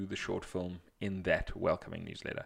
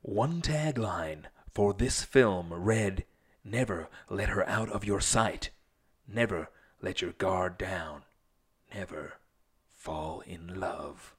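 movie trailer voice; slower than usual. Acted. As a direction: read it like an epic movie trailer. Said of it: "read the question in your movie, movie guy voice".

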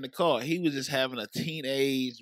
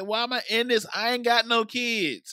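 exasperated; 9 semitones higher than usual. Retold or imitated. Retold exasperated complaint.

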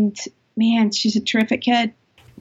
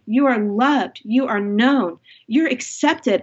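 ordinary talk

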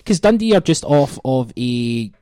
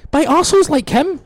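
mock outrage; 12 semitones higher, 7 dB louder than usual.

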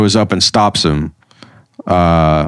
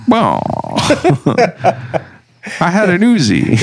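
high voice breaking into laughs; laughing; 10 semitones higher than usual. Retold or imitated. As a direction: giggle through the ghost's line. Ghost villain's dazed reaction, retold.

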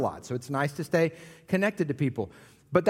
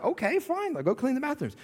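mock wife reply; 7 semitones higher than usual. Wife voiced in story.

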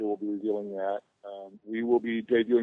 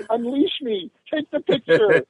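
higher, a little louder mock plea; silently begging to be let go, hammed up; pleading celebrity; 11 semitones higher, 5 dB louder, slower than usual. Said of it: "his eyebrows are talking to me".

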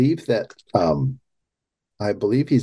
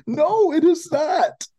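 emphatic denial; 10 semitones higher than usual. Real, not acted. Emphatic rebuttal of the quote.

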